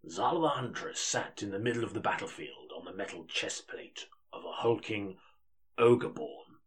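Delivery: measured audiobook narration; story narration. Acted. Narrator opening the fantasy audiobook, measured storytelling. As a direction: read it like an epic audiobook.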